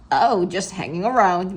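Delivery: casual punning principal; breezy grown-up quip; principal's voice. Acted.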